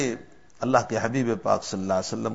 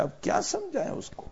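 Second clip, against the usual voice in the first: sharp, much higher exasperated question; the saint snaps back, fed up with the boy; irritated rebuke; 4 dB quieter, faster than usual. A narrator's reading. Saint refusing the pleading mother, in exasperated anger. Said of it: "حضرت حسن بصری غصے ہوئے".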